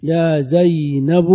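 "زينب" drawn out in full as "zaynabu"; full vocative form. Shown as a demonstration. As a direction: slowly sound the final "bu" of "زينب". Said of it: "تحذف التاء والضم". (نطق "زينب" كاملاً بضم الباء مع المدّ)

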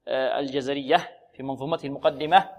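ordinary talk

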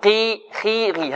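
قي خي غي kept heavy with i; heavy even with kasra. Shown as a demonstration. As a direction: keep قي thick despite the kasra. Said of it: "وإذا كانت مكسورة نقول".

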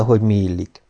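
ordinary delivery

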